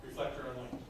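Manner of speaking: low, soft mock German machine voice; a hint of deep German accent. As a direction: lightly imitate a deep German-accented announcement. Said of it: "sends you back in a deep German voice".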